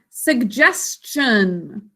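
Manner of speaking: suggestion stretched very slowly, syllable by syllable; slow model word. Shown as a demonstration. Teacher modelling the new noun, very slow. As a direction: draw out suggestion so each syllable is heard. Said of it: "Let's turn it into a noun".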